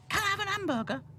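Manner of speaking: put-on very high, loud child voice; boy begging. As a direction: pipe up like a pestering boy. Boy Boz pesters Ethel.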